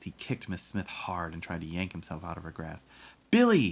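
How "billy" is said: put-on high, very loud child's shout. A narrator's reading. Boy calls to his friend on the train, shouting. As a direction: yell after a departing friend. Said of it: "he shouted".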